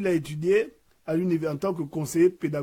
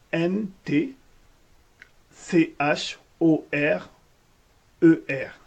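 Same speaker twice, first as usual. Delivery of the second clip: slow spelling; 5 dB louder, much slower than usual. Real, not acted. Spelling a name into voicemail.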